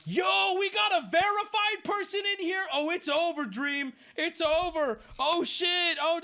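mock the gloating fan, high and hyped; hyped-up stan. Mocking Dream stan's tweet.

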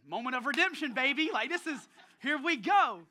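retold burst of excitement; mock triumph. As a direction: act out thinking your big moment has come. His excited thought, acted out.

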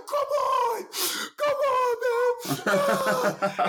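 high-pitched frustrated crying imitation; hammed-up wailing plea, voice way up high; mock crying. Frustrated driver banging on a car, mock crying. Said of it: "the dude starts crying".

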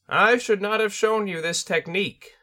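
high bird spirit voice, heavy with worry; a worried sensei, high; concerned Tengu. Acted. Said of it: "its voice thick with concern".

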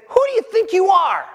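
loud, high, scornful demand; angry crowd confronting Jesus, loud and high; indignant challenge. Shown as a demonstration. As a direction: hurl it like an outraged accusation. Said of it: "That's the way you gotta say that".

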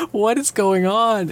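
slow, high bewildered question; baffled high quote. Retold or imitated. Retelling the sidekick's stunned reaction, high and slow. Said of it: "sitting there like".

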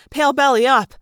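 plain reading voice

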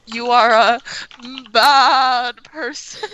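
drawn-out, deliberate words; slow solemn insult. Pretend. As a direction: pronounce it slowly like a verdict.

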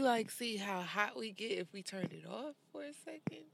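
real soft whisper. Real, not acted. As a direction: breathe the question out under your breath. Asks to turn the air off.

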